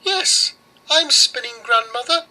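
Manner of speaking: put-on very high mouse faking the girl. Mouse answers Baba Yaga as the girl, very high. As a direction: squeak sweetly to fool the witch. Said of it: "The mouse answered".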